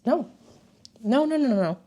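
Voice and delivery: self-correcting no. Real, not acted. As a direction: stop yourself mid-story. Catches herself skipping ahead.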